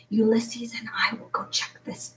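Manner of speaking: whispered. A narrator's reading. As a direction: whisper it, sneaking up. Sneaking to kitchen, hushed.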